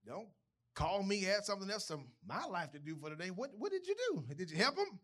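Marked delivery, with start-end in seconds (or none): 3.3-5.0 high, sharp disbelieving question; incredulous. Retold or imitated. Pastor challenging a member who did nothing, incredulous. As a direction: ask it like you can't believe it.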